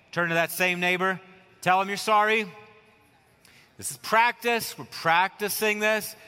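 ordinary talk

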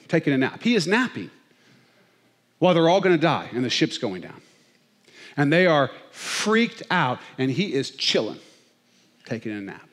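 usual speaking voice

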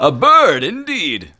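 high, loud character voice; a bird, declared with high grandeur; NPC voice. Acted. GM voicing a character, loud and high.

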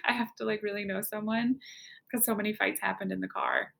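normal talking voice

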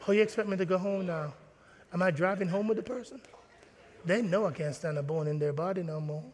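mock hushed gossip. Mock congregation murmur reaction.

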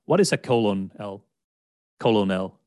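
colonel misread as COLON-EL; three-beat COLO-NEL, as spelt; misread spelling pronunciation. Retold or imitated. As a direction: ask with Colonel sounded out as "colo-nell". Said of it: "it sounds like colon".

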